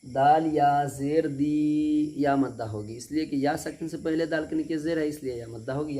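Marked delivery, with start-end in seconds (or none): 0.0-2.1 slow spelling, final दी held long; stretched ya madd. Shown as a demonstration. Teacher spelling syllable by syllable, sustained दी. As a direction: draw out दी for one alif. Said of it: "खींच करके अदा करेंगे".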